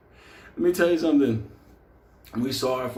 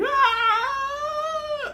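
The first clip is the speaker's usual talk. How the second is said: high, shrill imitated horror scream. Mikey screaming in horror on entering the room. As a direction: let out a sudden terrified cry. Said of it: "Mikey let out a fucking scream of, of fucking horror".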